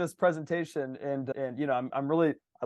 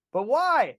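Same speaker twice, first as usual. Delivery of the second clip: incredulous why; 10 semitones higher, 6 dB louder than usual. Real, not acted. Mocking pointless effort.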